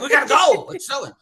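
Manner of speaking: high, loud alarm that drops on the end; hammed-up urgent warning to coworkers; mock panic. Acting out own panic at snow.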